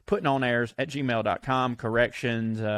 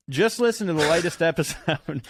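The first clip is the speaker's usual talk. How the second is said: higher read dissolving into laughter; laughing while reading; 3 semitones higher than usual. Real, not acted. Breaks up reading the email.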